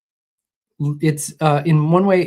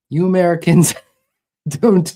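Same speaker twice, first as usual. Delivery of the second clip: higher, louder, breaking into laughter mid-line; cracking up mid-quote; 4 semitones higher, 8 dB louder than usual. Acted. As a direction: try the accent and lose it to a laugh. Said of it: "like the eighties W W F Iron Sheik accent".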